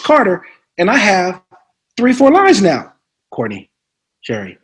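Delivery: ordinary talk, nothing marked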